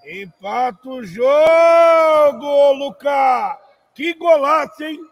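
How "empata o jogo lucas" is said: slow loud celebratory shout; excited shout. Real, not acted. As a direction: stretch it out excitedly. Goal celebration.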